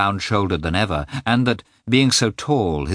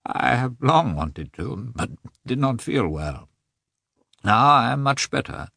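hollow voice; 4 semitones higher than usual. A narrator's reading. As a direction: let the words come out numb and hollow. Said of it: "said Nikolai in a hollow voice".

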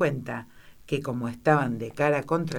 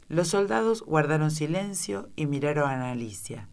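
ordinary delivery, nothing marked

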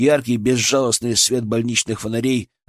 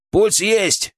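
higher shouted report; shouting; 9 semitones higher than usual. A narrator's reading. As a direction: call it out. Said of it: "крикнул анестезиолог".

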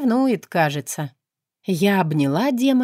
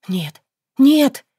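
second no screamed much higher and louder; rising into a scream; desperate no; 7 semitones higher, 7 dB louder than usual. Acted. Heroine refuses as house burns.

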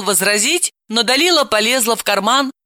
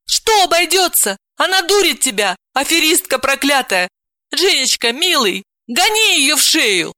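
higher, urgent pleading; 9 semitones higher than usual. Acted. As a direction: make him see sense. Aunt urges nephew.

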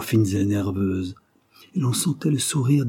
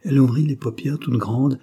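ordinary delivery, nothing marked

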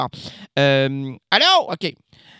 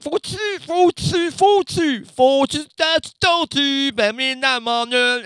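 stammering mock voice; 8 semitones higher, 4 dB louder, slower than usual. Stammering old voice.

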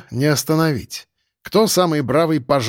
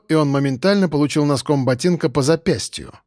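ordinary delivery

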